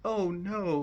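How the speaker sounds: high mock "oh no" of dismay; retold dismay. Viewer recalling his childhood reaction to the ending, retold dismay. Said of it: "And I went".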